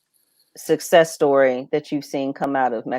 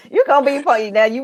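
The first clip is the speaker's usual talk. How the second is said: laughing; 9 semitones higher, 3 dB louder than usual. Real, not acted. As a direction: still laughing mid-word. Host still laughing.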